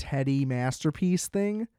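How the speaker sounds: ordinary delivery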